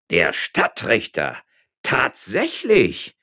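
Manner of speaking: slow, loud, stretched-out amazement; drawn-out stunned exclamation; utterly astonished. A narrator's reading. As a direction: say it slowly, voice leaping up in disbelief. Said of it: "erklärte Herr Juan López ganz erstaunt".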